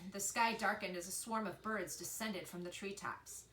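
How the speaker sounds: usual speaking voice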